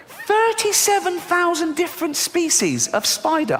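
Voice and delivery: very high, slow squeal of outrage; high-pitched disbelief. Acted. Comic telling audience how many spiders exist, squeaky disbelief. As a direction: repeat it way up high, drawn out. Same words repeated in disbelief.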